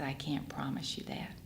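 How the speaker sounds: whispered grave reply, breath only; doctor giving the parents grave news, in a whisper; hushed doctor. Retold or imitated.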